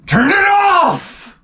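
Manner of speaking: very high, drawn-out agonized wail; hammed-up monstrous howl, stretched right out; George C. Scott. Father breaking down at his daughter's film, howling wail. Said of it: "he's turning into Frankenstein's monster".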